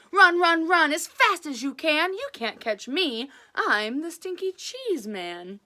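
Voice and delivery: loud sing-song taunt; cheeky runaway's chant; taunting call. Acted. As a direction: call back teasingly while running.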